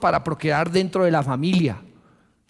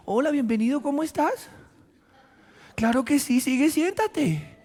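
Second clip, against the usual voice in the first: sweet salesperson greeting; 8 semitones higher than usual. Retold or imitated. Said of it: "le dice".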